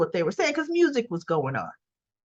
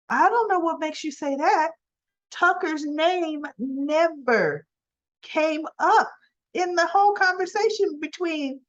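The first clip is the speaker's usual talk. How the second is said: high, slowed, incredulous; puzzled boyfriend; 7 semitones higher than usual. Retold or imitated. Boyfriend answering Abby's accusation, high and incredulous. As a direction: question her claim in disbelief. Chance voiced, puzzled denial.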